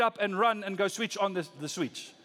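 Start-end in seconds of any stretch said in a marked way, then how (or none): none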